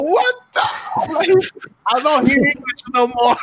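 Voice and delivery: high, squealed mock disbelief; retold astonishment, pitched high; amazed. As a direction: react in hammed-up bafflement, voice shooting up. Acting out amazed reaction to patois.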